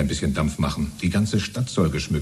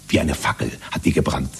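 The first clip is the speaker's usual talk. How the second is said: ordinary delivery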